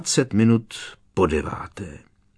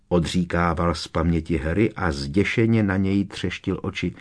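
ordinary delivery

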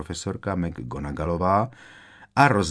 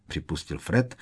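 plain reading voice

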